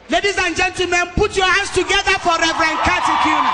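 loud, high, fast announcer call; announcer intro. Real, not acted. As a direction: hype the crowd for the next preacher. Introducing next speaker.

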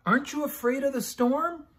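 high, small scared question; a pup trembling in the storm; frightened puppy voice. A narrator's reading. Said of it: "he asked".

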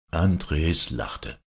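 usual speaking voice

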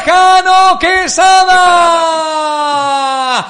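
high, slow, shouted name; drawn-out shout. Real, not acted. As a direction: hold the name long. Another big save.

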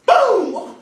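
explosive, high "boom"; shouted impact noise. Acted. Swatting a roach on the table, explosive shout. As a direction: blast it out like a sudden smack.

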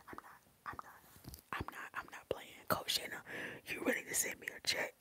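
secret unvoiced whisper. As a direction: hush it like a confession you shouldn't make. Jokingly takes back the joke.

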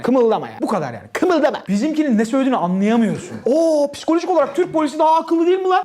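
ordinary delivery

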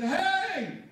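high, loud joyous announcement in broken English; excited joyful shout. Retold or imitated. New convert announcing to the teams, joyful loud shout. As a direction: call it out to the whole team, overjoyed.

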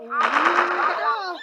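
slow, loud drawn-out cheer; excited rally cry. Real, not acted. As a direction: stretch it out to rally the team. Rallying team in game.

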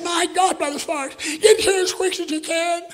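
high, loud panicked plea; wife frantically calling the preacher on the phone; frantic scream. Retold or imitated. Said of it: "She's screaming on the phone".

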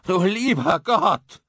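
loud, high, horrified cry; a man crying out in terror; sheer horror. A narrator's reading. Man in the dream cries out at horror, loud and high. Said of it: "darin schieres Grauen".

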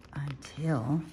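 plain reading voice

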